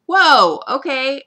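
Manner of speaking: loud, high mock shock; hammed-up taken aback; startled reaction. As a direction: jump back with a big reaction. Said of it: "and I'm like".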